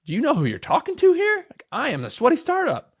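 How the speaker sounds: high, pompous mock outrage; jokingly playing the offended boss, pitched high and pompous; mock defensive indignation. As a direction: puff yourself up, pretending offence. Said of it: "At first I got defensive".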